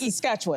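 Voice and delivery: "Saskatchewan" with a short -wun ending; local pronunciation. Retold or imitated. Anchor voicing locals' correction, "Saskatchewan" ending in wun. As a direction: reduce the last syllable of "Saskatchewan". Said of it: "learn how to say it's".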